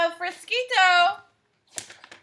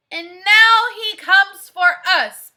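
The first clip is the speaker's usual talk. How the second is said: dramatic warning; 4 semitones higher, 10 dB louder than usual. Acted.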